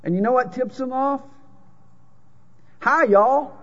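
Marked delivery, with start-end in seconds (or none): 2.8-3.5 Southern drawl, higher and louder; Southern accent played up big for a crowd. Shown as a demonstration. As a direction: show off a thick Southern drawl greeting. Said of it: "My accent is a dead giveaway".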